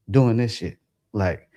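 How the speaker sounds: ordinary talk, nothing marked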